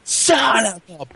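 high, long drawn-out shout; imitated angry yell. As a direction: imitate a gamer's outburst, stretching each word. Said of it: "we'll hear someone go like".

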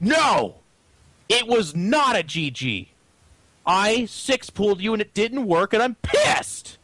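hammed-up, high, drawn-out angry shout; mock outraged stand. Mimicking players who refuse to GG, hammed-up outrage. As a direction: play a sore loser making a defiant stand. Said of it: "make this final stand".

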